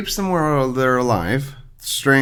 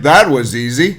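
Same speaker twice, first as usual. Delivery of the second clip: a hint of a recorded desk button, louder; lightly voiced button recording; 6 dB louder than usual. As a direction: imitate the push-button catchphrase with mild punch. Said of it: "the Staples easy button".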